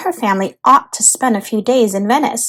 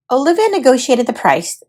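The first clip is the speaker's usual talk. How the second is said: usual speaking voice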